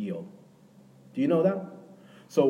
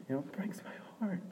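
much softer, whispered sorrow; heartbroken hush; 8 semitones higher, 10 dB quieter than usual. Real, not acted. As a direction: let it break off into a whisper. Pastor pictures ungrateful daughter.

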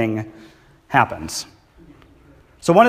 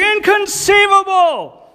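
slow, very high shouted disbelief; hammed-up incredulous shout; shocked Italian guy; 5 dB louder, slower than usual. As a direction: stretch it out in a high squawk of shock. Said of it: "he just looks down, he's like".

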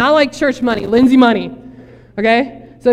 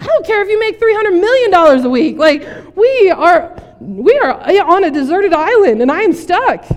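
high, loud exasperated yelling; hammed-up frantic castaway, slowing to hammer each word; freaking out; 8 semitones higher, 6 dB louder than usual. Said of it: "one guy is freaking out".

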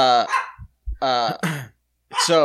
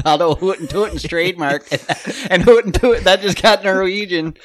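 put-on louder, laughing Chicago accent sliding into Norwegian; Chicago accent drifting into Norwegian, laughing; accent slipping Norwegian; 4 semitones higher, 5 dB louder than usual. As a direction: let the Chicago accent slip north. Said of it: "you're slipping north there".

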